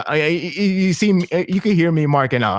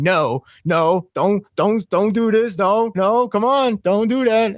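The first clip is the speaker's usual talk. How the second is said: pleading, rising higher with each no; mild mock yell; 7 semitones higher, slower than usual. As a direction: beg the screen to stop, half-performed. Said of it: "I'm, like, screaming".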